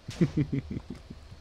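breathless high giggle; giggling. Real, not acted. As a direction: giggle helplessly under breath. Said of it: "Why the fuck am I giggling".

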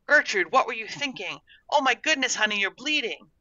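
high-pitched parental alarm; alarmed fussing. A narrator's reading. Parent finding the lost girl bleeding, alarmed and high-pitched.

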